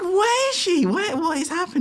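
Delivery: very high-pitched bewildered squeal; baffled mock reaction. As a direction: act out comic bewilderment way up high. Acting out baffled reaction, laughing.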